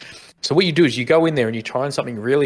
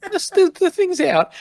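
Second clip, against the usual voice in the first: much higher, squeaky hesitation. Retold or imitated. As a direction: squeak it out awkwardly. Awkward reply in the shop.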